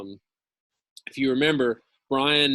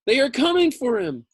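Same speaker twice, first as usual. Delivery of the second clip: excited cry; 12 semitones higher, 6 dB louder than usual. A narrator's reading. Boy's excited thought in story.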